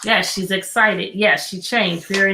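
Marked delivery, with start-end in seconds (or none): none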